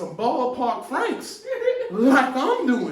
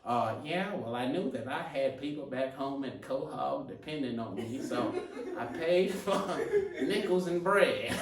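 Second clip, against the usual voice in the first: lightly voiced Cleveland Brown, lower and softer; a mild, mumbly take on the Cleveland Brown cartoon voice; 8 semitones lower, 7 dB quieter than usual. Scottie Pippen giving his speech, a light Cleveland Brown impression. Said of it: "the Cleveland Brown accent".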